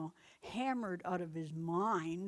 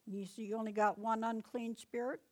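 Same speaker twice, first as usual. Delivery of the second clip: ordinary delivery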